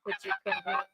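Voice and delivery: very high squeaky voice changer; chipmunk voice. Acted. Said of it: "How about this one?".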